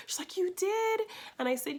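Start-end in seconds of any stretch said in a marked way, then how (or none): 0.4-1.0 high, delighted squeak of surprise; an old woman amazed at a kind stranger; surprised. Retold or imitated.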